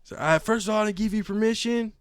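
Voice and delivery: high, loud mocking voice; mocking retort. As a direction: throw his words back at him. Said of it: "mocking the man".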